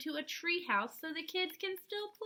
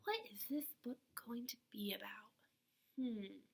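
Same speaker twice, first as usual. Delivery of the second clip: put-on whispering a wondering question to the kids; hushed, curious whisper; 2 semitones lower, 6 dB quieter than usual. Shown as a demonstration. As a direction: lean in and whisper to little listeners. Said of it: "I want you to think".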